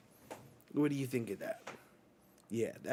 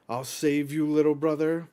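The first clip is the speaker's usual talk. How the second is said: heroic call; 3 semitones higher, 6 dB louder than usual. Retold or imitated. Hero rushes in, voiced loud.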